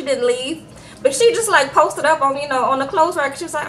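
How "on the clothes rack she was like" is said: normal talking voice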